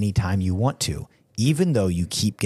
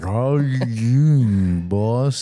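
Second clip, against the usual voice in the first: put-on drunk slurring; 2 semitones higher, much slower than usual. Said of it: "a slurro speechometer".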